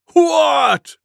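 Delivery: high loud what; bellow. Acted. Wild Buffalo bellows in alarm. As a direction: bellow in shock. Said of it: "bellowed Wild Buffalo".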